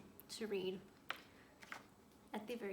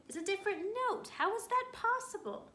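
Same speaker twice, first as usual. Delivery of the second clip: much higher, louder and fast, building in wonder; amazed; 12 semitones higher, 9 dB louder than usual. Acted. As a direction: rattle it off in disbelief. Dodsworth amazed by changed note.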